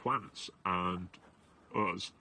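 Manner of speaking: normal talking voice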